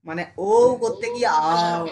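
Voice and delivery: drawn-out yawning আও, slow and loud; mock yawn. Shown as a demonstration. Teacher imitating students yawning the vowel. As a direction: yawn the sound out like a bored student. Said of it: "তোরা হাই উঠিয়ে দিচ্ছিস".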